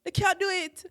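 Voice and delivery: put-on high, loud Jamaican accent quoting gran. Granddaughter re-enacting her exchange with gran, high, loud Jamaican accent. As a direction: exclaim it in a Jamaican accent, pitched right up. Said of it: "when you do the accent, yeah?".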